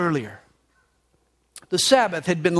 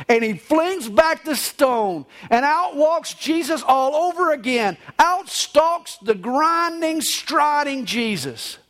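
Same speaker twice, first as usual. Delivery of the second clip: high, slow, emphatic proclamation; triumphant poem recital; 7 semitones higher than usual. Acted. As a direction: proclaim it with drawn-out high words.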